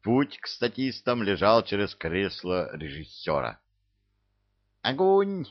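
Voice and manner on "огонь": eager command. Acted. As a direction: fire the order like a gleeful imp. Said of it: "азартно скомандовал самый вредный бельчонок". (азартная команда)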